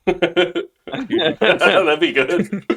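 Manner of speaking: slow laughing words. Real, not acted. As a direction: laugh through it. Laughing reply.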